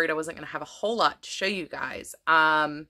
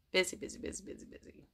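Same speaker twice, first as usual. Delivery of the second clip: whispered; 13 dB quieter, faster than usual. Real, not acted. Tired sigh about schedule.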